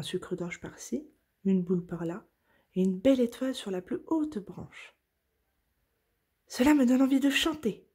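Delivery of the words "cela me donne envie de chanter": higher and louder, bubbling with joy; excited exclamation. Acted. Oui-Oui by the Christmas tree, excited exclamation. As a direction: burst out eagerly, wanting to sing. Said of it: "s'exclama Oui-Oui".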